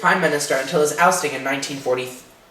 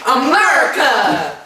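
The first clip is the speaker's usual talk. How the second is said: slow, much higher, drawn-out cry; patriotic cheer; 9 semitones higher, 5 dB louder, much slower than usual. Acted. Host gloating over the Cold War win with a cheer. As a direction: stretch America! into a proud rallying cry.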